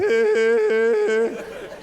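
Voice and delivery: high, loud fake laugh; mock high cackle. Son laughing at dad's joke.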